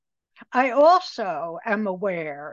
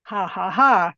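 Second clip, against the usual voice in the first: louder, spoken ha-ha-ha; dry imitation of others laughing; mock laughter; 5 dB louder than usual. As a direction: imitate teasing laughter without really laughing. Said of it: "everybody would laugh".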